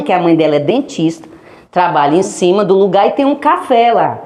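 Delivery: normal talking voice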